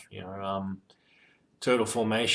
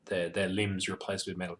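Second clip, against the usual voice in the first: ordinary talk, nothing marked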